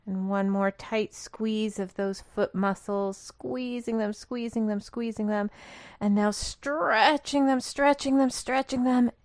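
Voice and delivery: building intensity. Real, not acted. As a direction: urge them to hold the strain, repeating with rising force. Urging effort in tense-and-release exercise.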